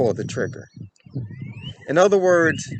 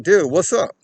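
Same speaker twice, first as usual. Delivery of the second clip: higher, louder call to a stranger; 6 semitones higher, 6 dB louder than usual. Retold or imitated. Confronting a man stuffing a girl into a car, loud call. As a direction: hail him sharply from a few steps off. Calling out to abductor.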